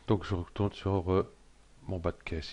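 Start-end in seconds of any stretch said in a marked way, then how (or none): none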